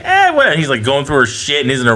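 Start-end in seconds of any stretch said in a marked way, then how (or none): 0.0-0.5 mock clueless mom. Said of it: "the mother's just like".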